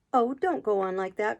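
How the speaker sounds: plain reading voice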